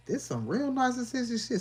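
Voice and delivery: high, loud disbelief; incredulous rant peak; exasperated. Real, not acted. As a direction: throw it up in exasperation. Exasperated outburst.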